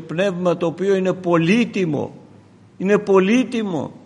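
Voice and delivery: high, loud pulpit proclamation; full-voiced sermon delivery; fervent preaching. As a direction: preach it out to the congregation.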